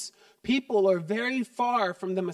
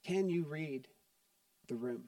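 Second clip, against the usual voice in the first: quiet altar call; 5 semitones lower, 9 dB quieter than usual. Acted. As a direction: gently invite the congregation inward.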